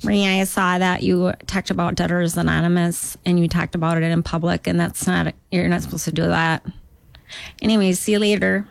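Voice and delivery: imitated Duluth accent, mild reproach; scolding Duluth local. Duluth local scolding the comic about her act, Duluth accent. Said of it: "Are people from Duluth always scolding?".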